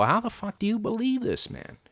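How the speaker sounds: high-pitched disbelief, voice jumping up; incredulous. Retold or imitated. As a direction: ask it as if you can't believe anyone buys it. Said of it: "you just sit there and go".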